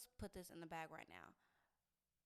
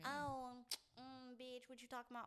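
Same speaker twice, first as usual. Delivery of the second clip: higher, sassy put-on voice; 5 semitones higher than usual. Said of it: "All that".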